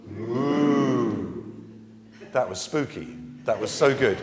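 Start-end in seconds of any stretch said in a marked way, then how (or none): 0.1-1.4 a long drawn-out cow moo. Shown as a demonstration. Leader shows children a cow noise, drawn-out moo. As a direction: moo for the kids to copy. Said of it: "Go moo".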